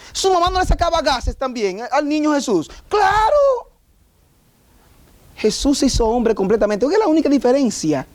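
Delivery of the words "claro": sarcastic of course. Real, not acted. Sarcastic answer about Jesus' humanity.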